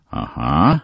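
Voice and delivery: ordinary delivery, nothing marked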